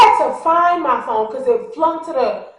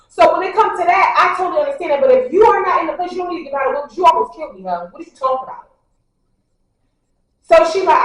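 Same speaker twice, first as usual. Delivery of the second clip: ordinary delivery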